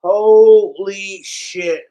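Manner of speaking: slow, loud, amazed holy shit; stunned exclamation. Real, not acted. Streamer amazed by four huge bottles, slow loud gasp of surprise. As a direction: let it drop out of you slowly, floored. Opening fans' big vodka gift.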